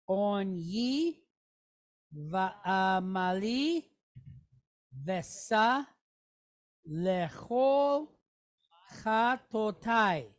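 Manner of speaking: slow, word-by-word Hebrew reading; slow read-along. Shown as a demonstration. Teacher leading the class in reading Hebrew aloud, slow. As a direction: read it slowly so the class can follow. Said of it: "Let's read it together".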